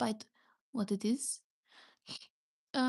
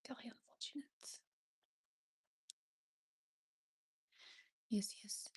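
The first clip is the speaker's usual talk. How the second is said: breathy dramatic whisper aside; 12 dB quieter, faster than usual. Real, not acted. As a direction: whisper it like a secret. Dramatic aside after US remark.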